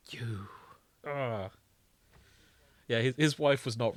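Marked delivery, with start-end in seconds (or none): 0.1-1.5 breathy whispered reaction. Retold or imitated. Retelling her reaction to the show, whispered. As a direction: hiss it under your breath in disbelief. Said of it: "whispered reaction".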